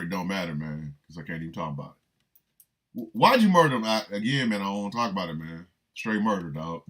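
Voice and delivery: low, soft and evasive; a hint of a brooding convict; lightly voiced tough inmate. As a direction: mumble it low, shutting the question down. Inmate refusing to talk.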